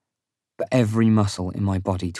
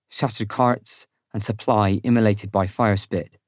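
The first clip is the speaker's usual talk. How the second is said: usual speaking voice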